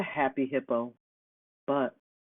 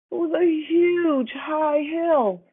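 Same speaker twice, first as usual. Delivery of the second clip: much higher and drawn out slowly; slow high emphasis; 12 semitones higher, 4 dB louder, much slower than usual. A narrator's reading. Narrator stressing how huge the hill is, high and slow. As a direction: stretch each word, up high and slow.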